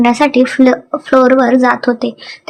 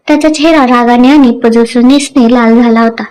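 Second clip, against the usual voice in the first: ordinary delivery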